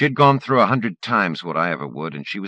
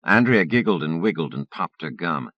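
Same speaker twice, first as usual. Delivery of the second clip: ordinary delivery, nothing marked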